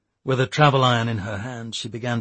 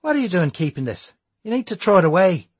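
sharp, raised angry snap; snapping; 7 semitones higher, 6 dB louder than usual. A narrator's reading. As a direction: snap it at him, louder. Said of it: "snapped Declan".

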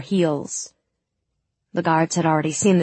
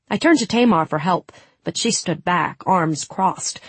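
usual speaking voice

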